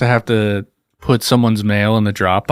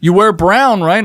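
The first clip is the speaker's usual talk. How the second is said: high, louder voice of an irritated man; high, louder mock customer griping at the mailman; mock grumpy customer; 9 semitones higher, 3 dB louder, slower than usual. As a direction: play the cranky guy complaining. Imitating same customer.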